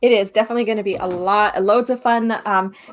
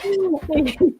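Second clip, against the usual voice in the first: giggle; 8 semitones higher than usual. Real, not acted. Laughing at silly comics idea.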